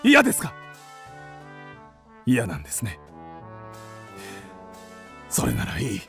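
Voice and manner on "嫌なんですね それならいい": low, soft, wounded acceptance; dejected resignation. Acted. Man turned down by the woman, dejected. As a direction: give up quietly, hurt. Rejected suitor gives up.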